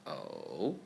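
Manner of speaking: O dipping low then rising, third tone. Shown as a demonstration. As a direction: drop O low, curl it up.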